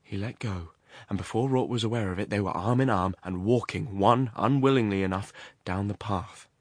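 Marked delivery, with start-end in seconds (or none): none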